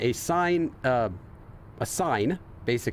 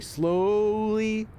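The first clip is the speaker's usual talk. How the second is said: "slowly" drawn out in slow motion; 9 semitones higher than usual. Shown as a demonstration. Translating a steering-wheel line for viewers, drawn-out slowly. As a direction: stretch "slowly" into one long held vowel.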